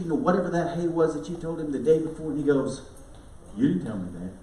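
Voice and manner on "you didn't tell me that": distracted husband.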